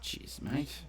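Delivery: ordinary talk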